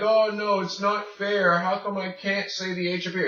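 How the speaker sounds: high-pitched mock complaint; whining student. Teacher mimics a student protesting the rule, whining. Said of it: "So if you're like".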